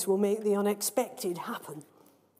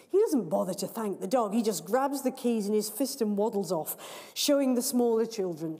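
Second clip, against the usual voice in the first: usual speaking voice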